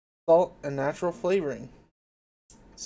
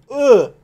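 loud ugh; 9 semitones higher, 15 dB louder than usual. Real, not acted. Hiccup after tasting sauce.

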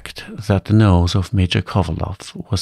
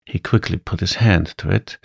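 plain reading voice

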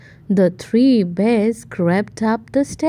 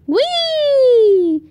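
high, louder squeal of joy; gleeful whee; 12 semitones higher, 3 dB louder than usual. Acted. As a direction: squeal like a child on a rocking chair. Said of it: "cried Goldilocks".

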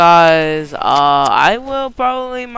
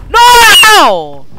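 a real scream, much higher and louder; anguished scream; 14 semitones higher, 11 dB louder than usual. Real, not acted. Gamer screaming No when the round falls apart. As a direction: scream No as it all goes wrong. Losing the fight.

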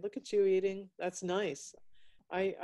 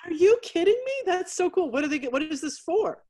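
much higher and louder amazement; excited; 10 semitones higher, 5 dB louder than usual. Real, not acted. Host amazed by a guest's zodiac sculptures, excited. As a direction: burst out in amazement.

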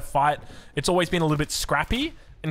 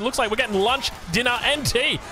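much higher hyped-up call; excited; 7 semitones higher than usual. Real, not acted. Caster hyping the English sandwich to viewers, high excited call.

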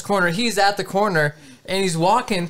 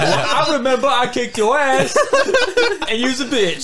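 mock drunk comeback; 7 semitones higher, 3 dB louder than usual. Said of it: "He's gonna come back. He's like".

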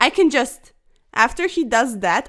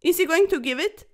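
ordinary delivery

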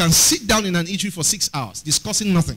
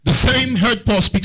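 slow, raised reading of the verse; scripture reading; 4 semitones higher than usual. Real, not acted. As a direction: quote the verse with weight. Preacher rereading the verse.

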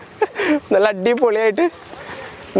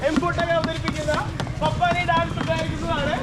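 slow, higher, softer announcer read; mock announcement; 7 semitones higher, 6 dB quieter than usual. Vlogger announcing the Pappanji dance to the park crowd, slow emcee read.

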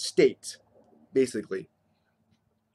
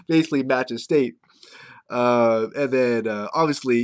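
ordinary talk

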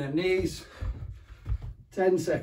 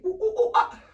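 high ooh-ooh-ah monkey calls; monkey noises; 14 semitones higher than usual. Shown as a demonstration. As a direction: hoot like a cheeky monkey. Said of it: "making the sounds".

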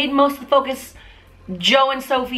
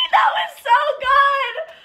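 thrilled squeal; 13 semitones higher, much slower than usual. Real, not acted. Thrilled reaction after music video.

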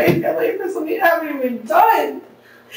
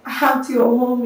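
ordinary delivery

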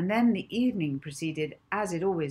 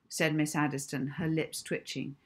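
ordinary delivery

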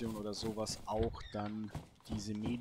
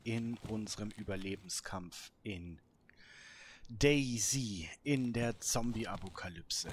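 slow, deliberate announcer opening; episode intro; 5 dB louder, slower than usual. Acted. Gamer greeting viewers at the start of the episode, slow and announcing. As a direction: welcome viewers to the episode, drawn out.

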